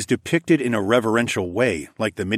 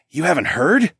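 astonished, higher, louder question; surprised; 7 semitones higher, 5 dB louder than usual. A narrator's reading. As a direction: gape in disbelief. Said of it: "Steve gaped".

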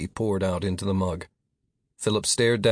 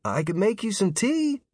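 high, lifted offer from another room; distant voice; 10 semitones higher than usual. A narrator's reading. Nick offers tea from the next room, distant voice. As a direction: offer it lightly from afar, pitched up. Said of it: "Nick's distant voice drifted in".

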